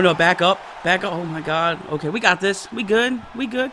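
ordinary talk

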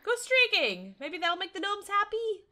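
high, loud playful urging; excited high lilt; cheerful cheer. Real, not acted. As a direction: call out brightly and hopefully. Cheers on a silly game action.

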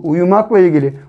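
ordinary talk, nothing marked

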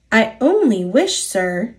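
plain reading voice

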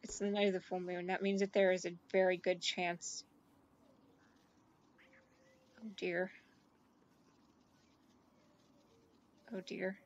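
low, soft, ominous murmur; worried murmur. Real, not acted. Streamer realizes full moon night, low worried murmur. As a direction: realize something bad is coming.

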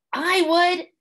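high, keen volunteer; eager Wombat. A narrator's reading. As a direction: jump at the chance.

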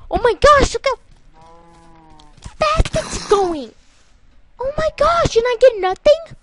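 high loud disbelieving oh my gosh; shocked exclaiming. Real, not acted. Player watching a fast zombie, shocked and loud. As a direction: gasp it out in real shock. Zombie races toward him.

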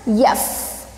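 a breathy unvoiced "F" hiss; f sound. Shown as a demonstration. Teacher showing students the "F" sound, unvoiced. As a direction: blow out the "F" sound. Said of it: "from the letter".

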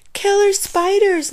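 loud, high-pitched scream. A narrator's reading. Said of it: "Nate screamed".

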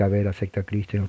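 low, soft, offhand invitation; murmured without any fervour; unemotional prayer. Shown as a demonstration. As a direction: say it as a tame, understated altar call. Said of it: "No era oración de que".

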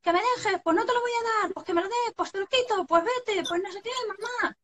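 high, whiny mock bickering; squabbling children. Siblings fighting and calling mum, high whiny bickering. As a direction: rattle off a kids' quarrel in a high voice. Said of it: "y de repente empiezan".

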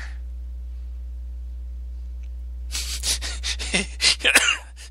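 high airy burst of laughter; wheezing laugh. Real, not acted. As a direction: choke back a silent laugh. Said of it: "I'm trying not to laugh and choke on air".

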